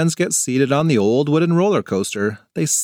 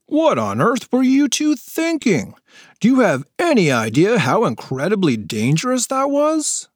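a worker's alarmed scolding; shocked adult; 9 semitones higher than usual. Acted. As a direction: tell off the reckless kids.

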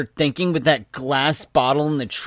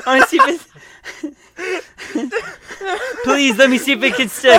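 high, loud, laughing beg; helpless giggly plea; pleading through laughter; 10 semitones higher, 6 dB louder, faster than usual. Real, not acted. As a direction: beg while losing it laughing. Pleading amid group laughter.

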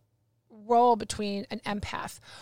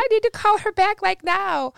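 high, loud retold urgency; urgent panic; 10 semitones higher, 7 dB louder than usual. Woman retelling hanging up on advisor, frantic. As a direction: act out a sudden frantic realisation.

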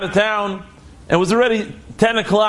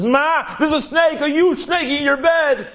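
put-on alarmed boy; 8 semitones higher than usual. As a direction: drag out the warning in shock.

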